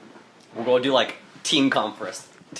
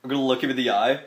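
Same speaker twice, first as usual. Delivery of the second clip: normal talking voice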